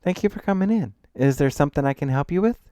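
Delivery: high, loud, chipper shop greeting; eager young shopkeeper. Acted. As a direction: pitch it up to welcome a customer. Narrator voices the shopkeeper heroine.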